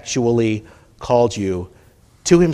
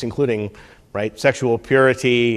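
usual speaking voice

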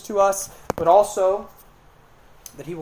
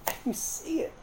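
high, breathy aside; hushed realization; 9 semitones higher, 9 dB quieter than usual. Real, not acted. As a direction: let the words fall to a hush. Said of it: "hushed realization".

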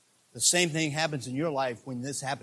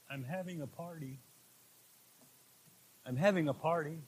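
very soft, almost breathed; hushed voice of Paul; 10 dB quieter than usual. Pretend. Paul quietly telling the church he is celebrating, hushed. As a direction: murmur it like a secret joy. Voicing Paul's joy, hushed.

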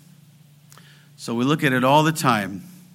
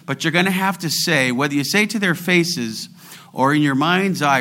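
ordinary talk

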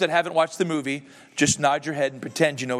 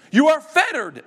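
high, louder frightened cry; trembling Scrooge; 10 semitones higher, 5 dB louder than usual. Acted. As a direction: gasp it out as scared Scrooge. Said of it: "said Scrooge, trembling".

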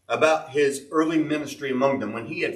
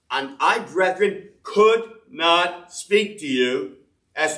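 solemn Bible reading, slightly louder; scripture reading; 2 semitones higher, 5 dB louder than usual. Acted. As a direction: read the verses out like a preacher. Reads scripture passage aloud.

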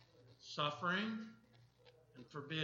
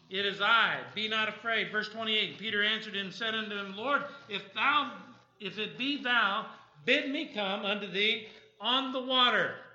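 raised, emphatic Bible reading; scripture reading; 4 semitones higher, 8 dB louder than usual. Acted. Preacher reads the storm story to the congregation, emphatic scripture reading. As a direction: project the verses with preaching force.

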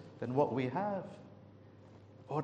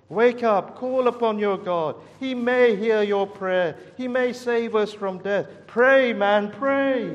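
higher, much louder desperate cry; urgent shout; 6 semitones higher, 9 dB louder than usual. Retold or imitated. Captain shouting at Jonah to pray, desperate loud cry. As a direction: yell at him to wake and pray. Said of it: "he seems to be saying to Jonah".

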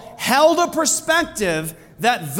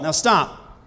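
normal talking voice

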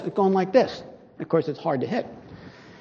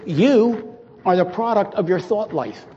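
usual speaking voice

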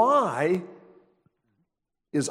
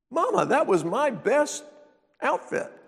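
high, whining complaint; whiny son; 9 semitones higher than usual. Retold or imitated. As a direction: whine like a sulking boy. Son whining about clothes.

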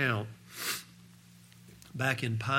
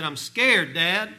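put-on higher, louder frightened little boy; scared child; 6 semitones higher, 9 dB louder, slower than usual. Boy tells his dad on the prairie he is scared.

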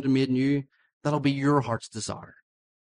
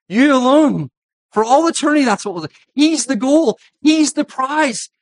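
higher, louder, building to the climax; preaching at full force; 9 semitones higher, 9 dB louder than usual. Acted. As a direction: proclaim it with mounting fervour. Sermon climax.

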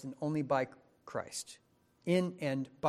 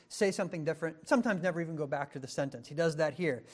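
normal talking voice

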